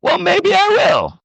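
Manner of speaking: high, slow flippant husband reply; husband answering the wife's test, flippant and high; mock careless husband. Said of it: "Is he gonna say".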